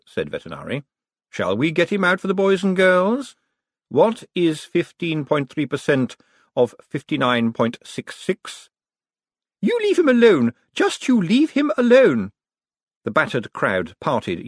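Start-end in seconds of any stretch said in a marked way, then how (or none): 1.3-8.7 higher-pitched showman coaxing an answer; mock children's entertainer; Vetinari. A narrator's reading. 9.6-12.3 high, loud protective outcry; indignant high-pitched shout; outraged, indignant. A narrator's reading. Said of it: "as outraged and indignant as a mother hen".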